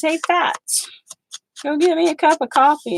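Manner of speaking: high mocking voice of a rude brother-in-law; lightly imitated bossy man; rude man quoted. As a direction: sneer it like a lazy husband ordering. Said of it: "he looked at me and he says".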